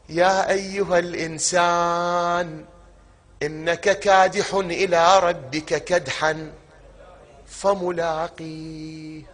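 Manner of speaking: slow, drawn-out chanted verse; Quran recitation. Acted. Preacher recites a verse to the congregation, slow chant. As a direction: intone the verse slowly and reverently.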